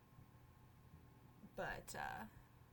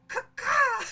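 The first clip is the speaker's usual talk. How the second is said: very loud high crow squawk; crow caw; 13 semitones higher, 19 dB louder than usual. Acted. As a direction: shriek it like a crow. Crow companion voiced in game.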